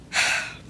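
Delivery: long weary sigh out; heavy sigh. A narrator's reading. Narrator sighing for fed-up Gideon. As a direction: let the frustration out in a sigh.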